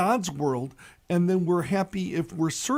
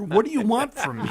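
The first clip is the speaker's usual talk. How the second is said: exasperated God; 4 semitones higher, faster than usual. Retold or imitated. God's exasperated punchline.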